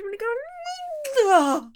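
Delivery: stretched high cry; drawn-out climax wail. Acted. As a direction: wail the last word long.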